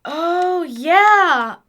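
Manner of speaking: loud, high, delighted gushing; thrilled. Acted. Charlie marveling at the clockwork inside Winston, loud excited delight. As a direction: gush over it like a gearhead seeing treasure.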